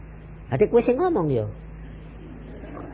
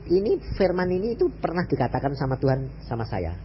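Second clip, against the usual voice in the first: ordinary delivery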